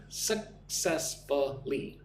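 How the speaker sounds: Successfully said slowly, syllable by syllable, stress on cess; slow syllable demo. Shown as a demonstration. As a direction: stretch out Successfully, hitting the second syllable. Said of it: "Put the emphasis on the second syllable".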